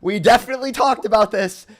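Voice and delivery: loud, high, incredulous; exasperated shouting. Real, not acted. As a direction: raise your voice in disbelief. Exasperated, talking over opponent.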